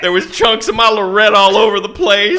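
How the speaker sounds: hammed-up country singer send-up. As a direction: wail the tragedy like a country storyteller. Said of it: "sounds exactly like [name]".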